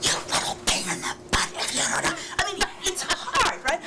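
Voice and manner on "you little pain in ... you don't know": a scold in a whisper, no voice. Shown as a demonstration. Parent trying to scold while whispering. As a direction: try to be angry but whisper it. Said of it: "hard to yell if you're whispering".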